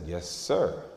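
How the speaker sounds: a small, hushed yes sir; timid quiet reply. Retold or imitated. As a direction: answer like a scared kid, very softly. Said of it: "And I said".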